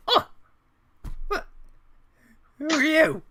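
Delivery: a flustered mother caught by surprise; startled old woman. Acted. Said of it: "the old woman will kind of run around".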